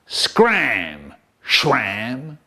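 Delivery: low snarl back; a low snarled retort. A narrator's reading. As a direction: growl it back at him. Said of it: "And Sheddle snarled back".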